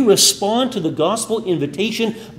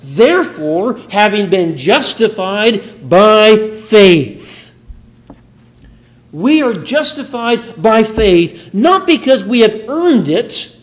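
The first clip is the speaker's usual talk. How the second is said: raised, louder preaching voice, pressing each point; fervent preaching; 6 semitones higher, 5 dB louder than usual. Acted. Pastor urging the church about faith, fervent preaching. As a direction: preach it out to the congregation. Preacher building to full force.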